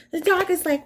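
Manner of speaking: ordinary delivery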